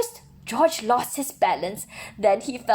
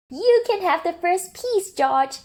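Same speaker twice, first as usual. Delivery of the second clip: higher, happy thank-you; higher, glad zookeeper voice; grateful zookeeper; 9 semitones higher than usual. Acted. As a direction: thank George warmly as the zookeeper.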